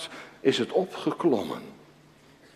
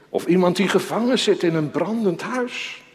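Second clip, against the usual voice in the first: normal talking voice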